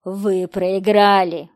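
loud, slow villain announcement; hissed gloating. A narrator's reading. Killer over the loudspeaker, loud slow menacing gloat. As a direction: gloat slowly and forcefully. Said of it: "прошипел голос".

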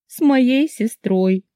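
high, very slow tearful wail; tragic. A narrator's reading. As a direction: draw it out as tragic news. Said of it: "добавила я трагично". (трагично, высоко и протяжно)

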